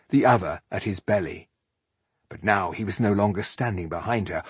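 ordinary delivery

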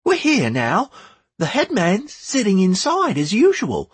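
put-on high boyish voice; boy talking eagerly; young boy voice. A narrator's reading.

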